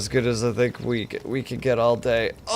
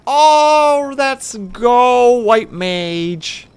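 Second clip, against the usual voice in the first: much higher, loud cheer; excited shout; 13 semitones higher, 12 dB louder, much slower than usual. Real, not acted. Gamer cheering her roulette role, loud and high. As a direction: cheer out loud at the lucky pick. Gets the role she wanted.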